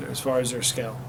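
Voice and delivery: ordinary talk, nothing marked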